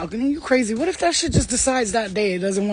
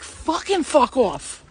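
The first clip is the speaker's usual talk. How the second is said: emphatic dismissal; 4 semitones higher, much slower than usual. Acted. Telling bad energies off.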